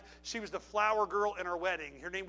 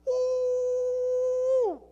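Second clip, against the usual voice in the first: a high, loud celebratory whoop; a full-throated woo of joy; holler; 11 dB louder than usual. Retold or imitated. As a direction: let rip a joyful yell. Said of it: "I shouted, I hollered".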